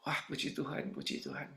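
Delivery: very hushed, low aside; almost whispered private praise; inner thought. Retold or imitated. Preacher secretly rejoices at six months apart, hushed inner voice. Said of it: "Dalam hati saya".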